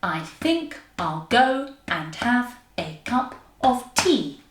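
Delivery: "I think I'll go and have a cup of tea" slowly beaten out in pairs; slow weak-strong pulse, stressing THINK, GO, HAVE, CUP, TEA; iambic rhythm. Shown as a demonstration. Teacher giving an example line, slow scansion. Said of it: "you can use that rhythm".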